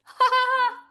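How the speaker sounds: short squealing laugh; delighted high laugh. Real, not acted. Host laughing at busy chat, high laugh. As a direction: burst of a giggle.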